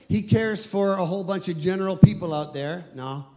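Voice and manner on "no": ordinary talk, nothing marked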